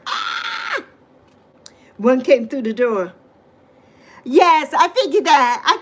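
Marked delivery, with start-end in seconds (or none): 0.1-0.8 high retold scream at a wasp; a quick high mock shriek. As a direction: squeal as if a wasp flew in. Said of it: "I was like".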